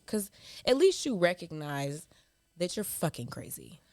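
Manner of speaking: ordinary talk, nothing marked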